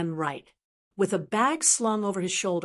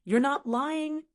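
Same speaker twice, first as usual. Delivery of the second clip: wronged; 6 semitones higher, slower than usual. A narrator's reading. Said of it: "said with a wronged expression".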